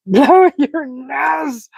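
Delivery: very slow, drawn-out "Blow your nose."; slow repeat. Retold or imitated. Streamer repeating the friend's order, slow and drawn out. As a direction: stretch every word of "Blow your nose.". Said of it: "He said".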